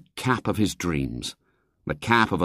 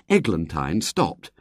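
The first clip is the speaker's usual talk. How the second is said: ordinary delivery, nothing marked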